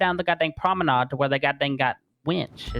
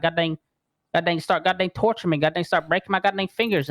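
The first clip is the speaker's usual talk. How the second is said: ordinary delivery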